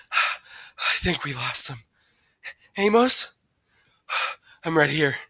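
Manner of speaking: panting between phrases; winded, breaths heard between lines. A narrator's reading. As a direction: gasp the words after a long run. Runners out of breath after chase.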